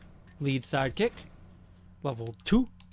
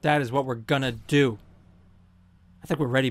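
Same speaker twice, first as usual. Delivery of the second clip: usual speaking voice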